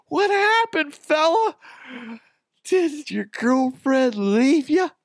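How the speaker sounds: high, teasing mock sympathy, slow at the end; mock concerned stranger.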